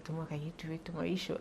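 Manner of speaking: hushed, second-guessing self-talk; soft, sceptical inner critic; doubting inner voice. Retold or imitated. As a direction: murmur it like a nagging doubt to yourself. Voicing her self-doubt to herself.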